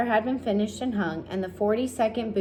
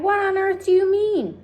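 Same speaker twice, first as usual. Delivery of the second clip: very high, loud demand; shocked, needing an answer; alarmed cry; 12 semitones higher, 6 dB louder than usual. A narrator's reading. The friends question the ladybug, high alarmed cry. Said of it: "they cried".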